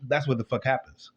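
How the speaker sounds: normal talking voice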